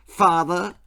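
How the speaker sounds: loud sharp call to her father; shouting. A narrator's reading. Toddler ordering her father about, loud. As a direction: bark it out bossily. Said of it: "she shouted".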